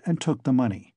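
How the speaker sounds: usual speaking voice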